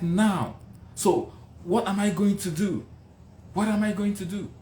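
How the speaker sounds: high-pitched helpless questioning; desperate pleading. Retold or imitated. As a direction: re-enact tearful despair after being rejected. Retold tearful despair after rejection.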